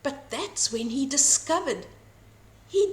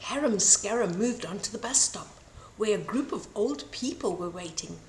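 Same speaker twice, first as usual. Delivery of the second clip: ordinary delivery, nothing marked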